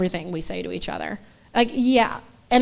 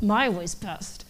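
stubborn; 2 semitones lower, 6 dB quieter than usual. Retold or imitated. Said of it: "digging my heels into the ground".

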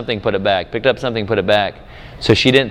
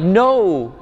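reassuring dad; 8 semitones higher, slower than usual. Retold or imitated. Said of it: "I'm like".

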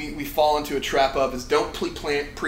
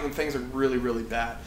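usual speaking voice

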